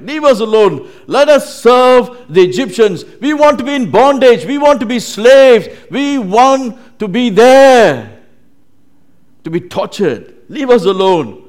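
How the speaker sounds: high, very loud defiant protest; shouted complaint. Acted. Israelites demanding that Moses let them stay slaves, shouted complaint. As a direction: cry out each demand at full force. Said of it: "They were just complaining".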